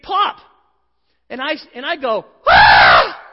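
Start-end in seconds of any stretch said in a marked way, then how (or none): 2.5-3.2 a loud, low, raw shout of alarm; startled scream. Retold or imitated. Husband hears the suction cup pop and lets out a loud startled scream. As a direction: bellow in shock at the pop. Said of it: "I start screaming".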